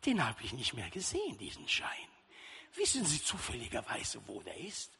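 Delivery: the sacristan questions the priest from the niche, low and sly. Retold or imitated. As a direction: turn the tables in a low sly voice. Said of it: "Und dann sagt der Peter".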